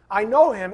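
loud stress on know; preacher insisting he knows him; emphatic. Real, not acted. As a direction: drive the word know home. Preacher repeats key phrase.

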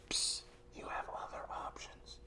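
hissing tempter whisper; sly serpent's whisper. Acted.

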